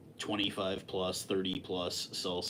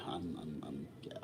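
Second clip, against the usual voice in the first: whispered; 4 semitones lower, 10 dB quieter than usual. Real, not acted. Trailing whispered confession.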